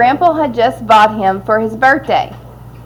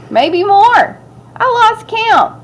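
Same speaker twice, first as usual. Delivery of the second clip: boasting; 11 semitones higher, slower than usual. Acted. Boy exaggerates his catch boastfully.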